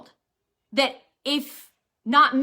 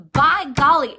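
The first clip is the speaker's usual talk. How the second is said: loud preaching burst; 10 dB louder than usual. Real, not acted. Preaching at full force.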